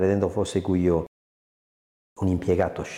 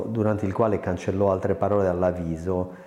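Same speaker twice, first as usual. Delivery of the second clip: ordinary delivery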